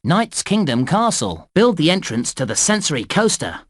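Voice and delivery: ordinary delivery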